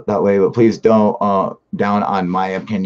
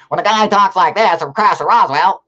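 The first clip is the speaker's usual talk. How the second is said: put-on high, loud old-time newsreel announcer; 1940s newsreel voice; 9 semitones higher, 5 dB louder than usual. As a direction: parody a forties news briefing, pitched way up. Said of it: "nineteen forty-seven talked like this".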